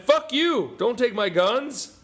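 high, loud mock yell, slowing on the last words; mock angry gun owner. Gun owners shout down the ban, high loud mock yell. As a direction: yell it like an outraged gun nut. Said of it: "fifty percent of you went".

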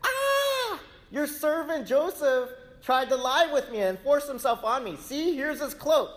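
high, loud mock scream of a false accusation; hammed-up high scream of the wife accusing Joseph; Potiphar's wife screaming. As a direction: shriek it out like the scorned wife crying foul. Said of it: "to scream and go".

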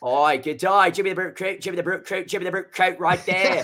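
a hammed-up Australian twang on "Oi, good day"; Australian accent. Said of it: "in an Australian accent".

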